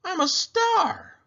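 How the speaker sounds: high and slow, thrilled; a gleeful aunt seeing herself in the mirror; excited exclamation. Acted. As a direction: savour each word with delight. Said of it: "shouted Aunt Mira".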